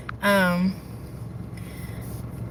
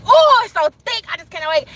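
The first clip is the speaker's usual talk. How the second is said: excited gasp; 7 semitones higher, 5 dB louder than usual. Retold or imitated. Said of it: "and I was like".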